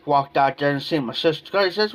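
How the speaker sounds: ordinary talk, nothing marked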